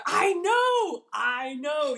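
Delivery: real excited exclamation; excited shout. Real, not acted. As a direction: cry it out in delight. Agreeing, carried away with excitement.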